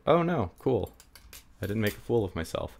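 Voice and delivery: ordinary talk, nothing marked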